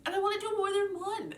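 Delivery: high whiny complaint. Real, not acted. Whining about limited time.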